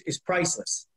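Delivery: ordinary talk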